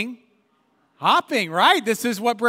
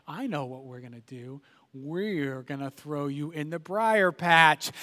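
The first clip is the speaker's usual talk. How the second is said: sly scheming fox; 7 semitones lower, 5 dB quieter than usual. Retold or imitated. Brer Fox revealing his plan to rabbit, low and sly. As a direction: lean in and plot quietly.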